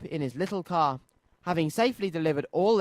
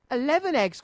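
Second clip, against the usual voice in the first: high, amazed question; delighted disbelief; a storybook toy finding eggs in his car, surprised; surprised cry; 9 semitones higher than usual. A narrator's reading. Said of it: "cried Noddy".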